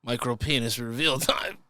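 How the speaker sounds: usual speaking voice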